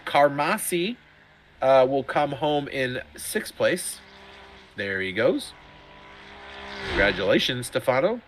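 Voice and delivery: usual speaking voice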